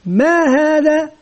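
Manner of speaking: drawn-out, slow "ما هذا؟" to the class; slow teacher question. Retold or imitated. Teacher to pupils at the board, asking slowly. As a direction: stretch the question for small children. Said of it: "مثلًا إذا قلت".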